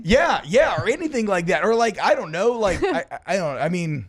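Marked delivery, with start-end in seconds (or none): 0.0-3.0 high, louder excited agreement; eager. Real, not acted. Fan jumps in eagerly to agree with friend, higher and louder. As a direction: jump in eagerly, pitch up.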